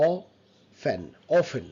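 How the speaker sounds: OFTEN split as "aw... fen"; syllable by syllable. Shown as a demonstration. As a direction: pause between the syllables of often. Teacher breaks word into syllables. (often ভেঙে "অ... ফেন")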